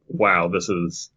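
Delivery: ordinary talk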